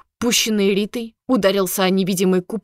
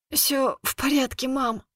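higher, soft and feeble; weak squeaky voice; 5 semitones higher, 5 dB quieter than usual. Acted. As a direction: squeak it out weakly. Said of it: "слабеньким голоском пропищала она".

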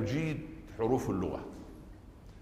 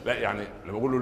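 ordinary talk, nothing marked

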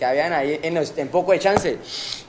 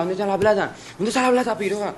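high-pitched mock interrogation; retold demand; 6 semitones higher than usual. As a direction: act out pressing the victim for money. Exige la plata a la víctima. (exigencia imitada, muy aguda)

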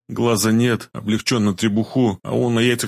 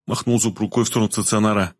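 usual speaking voice

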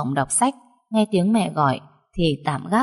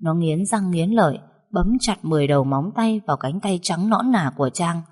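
plain reading voice

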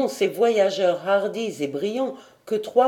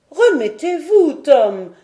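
high, louder lady's voice; soothing lady; 7 semitones higher, 7 dB louder, slower than usual. A narrator's reading. Lady Helena telling troubled sailor to recover, reassuring. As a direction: raise the pitch to reassure.

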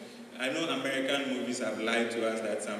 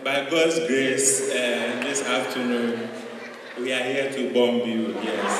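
loud, halting mock Ghanaian announcement; polite Ghanaian bomber, loud with long pauses; Ghanaian accent; 7 dB louder than usual. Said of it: "Ghanaian accent".

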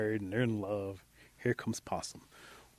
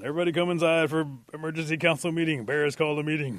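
loud, raised town-crier call; 5 semitones higher, 8 dB louder than usual. Acted. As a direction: summon the whole village. Chief calls the village to meeting.

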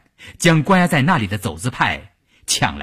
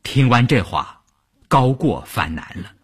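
usual speaking voice

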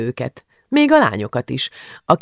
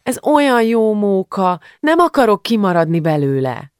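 whining; 7 semitones higher than usual. A narrator's reading. Julcsi begs not to miss the fun, whining. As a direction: plead in a sulky whine. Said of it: "nyafogott Julcsi".

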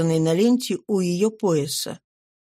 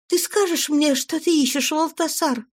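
higher woman's voice, worried and searching; pleading wife; 7 semitones higher than usual. A narrator's reading. Wife questions her changed husband, higher worried voice. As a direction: plead gently as an anxious wife.

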